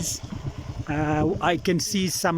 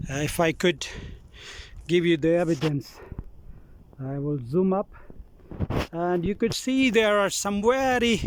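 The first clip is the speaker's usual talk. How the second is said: usual speaking voice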